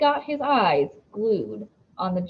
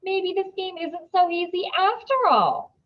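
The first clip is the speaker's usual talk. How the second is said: higher, playful knowing teacher; twinkling teacher; 10 semitones higher, 6 dB louder than usual. A narrator's reading. Missus Beam teases Dylan, playful and higher. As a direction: tease gently, pitched up. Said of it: "eyes are twinkling".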